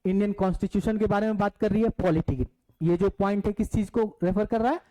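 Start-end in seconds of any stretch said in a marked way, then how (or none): none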